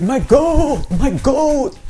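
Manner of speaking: high, loud cry after a runaway; distressed calling out. Retold or imitated. Man chasing his escaped goat, distressed shouting. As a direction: wail it desperately, imitating the owner.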